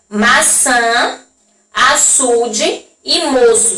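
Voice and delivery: "açude e moço" read slowly, each "ç" like s; slow demo words. Shown as a demonstration. Teacher slowly showing the cedilla sound to pupils. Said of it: "analisar aí que o som é o mesmo".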